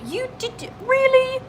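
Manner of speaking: high, delighted squeal; surprised. Real, not acted. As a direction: gasp it out in delight. Surprised at a sub.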